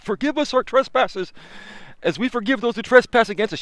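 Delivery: preacher voice. Retold or imitated. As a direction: echo the preacher, hushed and high. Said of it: "I was preaching like a preacher".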